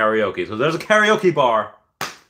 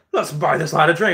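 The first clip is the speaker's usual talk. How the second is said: higher-pitched mock Irish accent; 5 semitones higher than usual. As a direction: imitate a generous Irish pub-goer. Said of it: "in the Irish accent".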